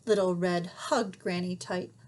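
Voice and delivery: plain reading voice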